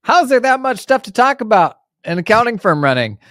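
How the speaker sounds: high, loud disbelieving question; incredulous quote. Retold or imitated. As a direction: play the doubters, high and amazed. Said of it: "And everybody's like".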